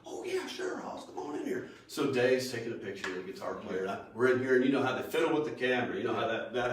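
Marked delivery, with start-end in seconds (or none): 0.1-1.7 very high-pitched country-star impression. Country star agreeing to a fan's picture, high mock welcome. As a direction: imitate a star's welcome, voice way up high. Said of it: "He goes".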